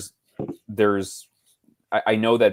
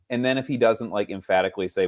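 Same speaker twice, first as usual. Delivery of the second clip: ordinary talk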